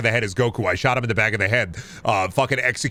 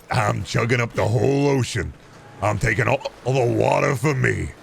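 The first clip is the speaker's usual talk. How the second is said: Badlands. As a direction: play the man drinking up the sea. Plays Badlands answering the onlookers.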